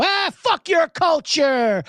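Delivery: hammed-up Italian-American scoff, slow; slow jeering Italian-American accent; mock Italian accent. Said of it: "they love mocking DeVito".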